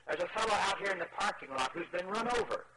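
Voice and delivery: high-pitched mock shout of alarm; acted cry for help, pitched high; urgent shouting. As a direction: call out urgently for the pastor. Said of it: "from outside shouting".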